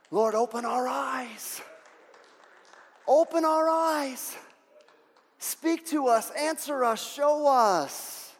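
high, drawn-out cry to God; stretched, high intercession; pleading prayer. Real, not acted. Prayer builds to a plea.

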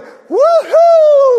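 high, loud excited whoop; joyful whoop. Retold or imitated. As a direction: cheer like a crowd that's just been saved. Israelites cheering after sea crossing.

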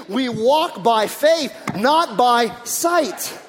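each word drawn out slowly and loud; slow emphatic verse. Real, not acted. Preacher stresses key verse.

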